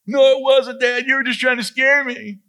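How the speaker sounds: put-on high, loud child's protest; Nora. Daughter accusing dad of scaring her, protesting. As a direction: imitate an indignant kid in a high voice.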